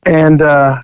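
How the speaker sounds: usual speaking voice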